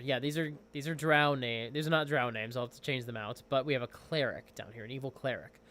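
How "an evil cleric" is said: ordinary talk, nothing marked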